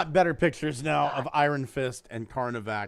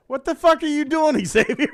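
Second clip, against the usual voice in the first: shouting through laughter at Xavier; laughing shout; 12 semitones higher, 8 dB louder than usual. Real, not acted. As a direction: blurt it out laughing loudly. Call chaos, laughing outburst.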